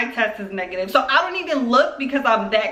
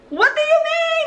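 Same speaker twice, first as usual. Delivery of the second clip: very high, incredulous mock squeal; hammed-up high-pitched delight; excited friend. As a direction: imitate a thrilled friend's squeaky question. Imitating excited coworker's reply.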